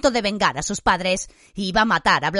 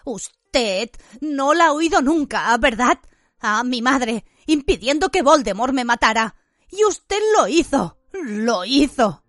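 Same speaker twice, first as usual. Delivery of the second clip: higher, emotional accusation, slowing at the end; increasingly trembling voice; 4 semitones higher than usual. A narrator's reading. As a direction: let the hurt build, then sink slowly. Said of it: "la voz cada vez más temblorosa".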